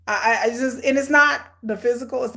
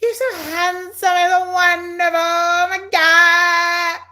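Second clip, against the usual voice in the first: high, sing-song fawning, dragged out slow at the end; over-the-top mock adoration, much higher; mock gushing flattery; 6 semitones higher, 4 dB louder, slower than usual. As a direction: ham up a swooning admirer. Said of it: "pepper you with platitudes and flattery".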